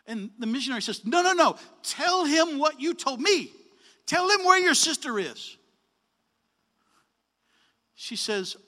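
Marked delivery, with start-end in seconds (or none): 1.1-5.6 much higher, louder insisting; eager retold insistence; insistent. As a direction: press her to tell the whole story. Missionary insisting to the clerk.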